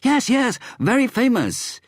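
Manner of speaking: very high, loud insistence; insisting. A narrator's reading. The man in the lift insists eagerly, very high and loud. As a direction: push it, high and excited. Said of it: "the man insisted".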